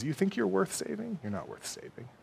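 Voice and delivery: insinuate worthlessness very softly; whispered lies. Acted. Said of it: "He whispers these lies and he says".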